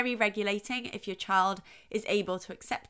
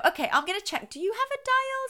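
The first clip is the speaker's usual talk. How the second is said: lightly playful parent, pitched up high; 12 semitones higher than usual. Retold or imitated. Parent checking a child for a dial, playful. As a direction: play along with the child, voice lifting high.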